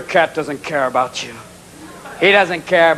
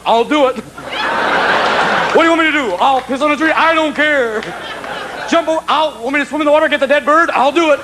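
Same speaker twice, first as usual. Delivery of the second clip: high, excitable dog voice; eager dog; 8 semitones higher than usual. Acted. Dog to owner at the park, frantically eager. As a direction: beg for the job, pitched up. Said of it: "It's always the dog going".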